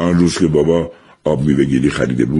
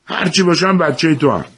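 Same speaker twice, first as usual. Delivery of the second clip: mild shout; 12 semitones higher than usual. A narrator's reading. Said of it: "جعفر هم از همونجا داد زد".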